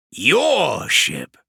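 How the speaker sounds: odd-toned scornful echo; mocking demon. Vashtor mocks Kravarek's claim, scornful. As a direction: toss his words back at him.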